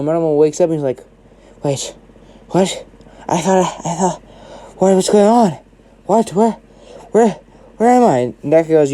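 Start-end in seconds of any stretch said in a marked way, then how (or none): 1.6-8.2 halting, confused waking-up babble; disoriented. Acted. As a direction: stammer in fragments, rising into bewilderment. Monoma wakes up disoriented.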